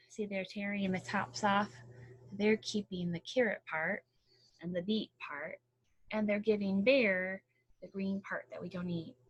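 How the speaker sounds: plain reading voice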